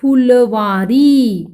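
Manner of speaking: फुलवारी very slow, vowels stretched; pronunciation demo. Shown as a demonstration. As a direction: sound out फुलवारी part by part. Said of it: "तो उच्चारण कैसा होगा?".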